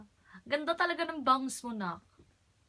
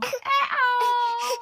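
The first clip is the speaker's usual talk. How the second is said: a squealed, laughing greeting; high laughing call; 9 semitones higher, 5 dB louder than usual. Real, not acted. Mother teasing her upset baby, high laughing call.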